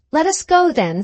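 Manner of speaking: impetuous burst, pitched high. A narrator's reading. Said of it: "said Gwendolen impetuously".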